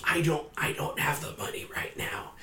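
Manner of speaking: scared, breathless whisper; cowering debtor whispering to his captor. Acted. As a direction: whisper it, too frightened to speak up. Threatened debtor pleading.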